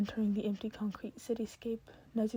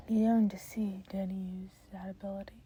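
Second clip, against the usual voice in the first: plain reading voice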